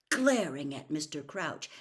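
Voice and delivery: usual speaking voice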